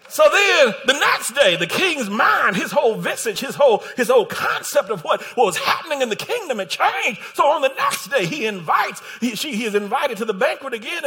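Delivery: loud, high-pitched sermon delivery; fired-up pulpit storytelling; full-force preaching. Real, not acted. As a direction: preach it at a shout, driving through the story.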